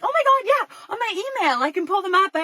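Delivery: very high, gushing imitation of a proud mom; eager excitement. Cousin eagerly offers wedding photos.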